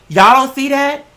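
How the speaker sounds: normal talking voice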